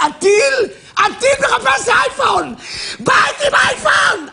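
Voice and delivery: high excited shout. Real, not acted. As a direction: hammer it at the audience. Preacher yelling about missiles. (צעקה נרגשת וגבוהה)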